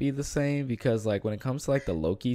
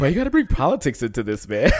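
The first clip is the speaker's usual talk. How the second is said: laughing mock troll; 3 semitones higher, 5 dB louder than usual. Mocking troll's complaint.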